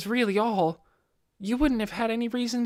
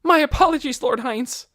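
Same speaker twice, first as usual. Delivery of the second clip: urgent cry of apology; loud apology; 5 semitones higher, 8 dB louder than usual. Acted. Maid crying out her apology to her lord. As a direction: blurt out the apology in alarm. Maid begs forgiveness loudly.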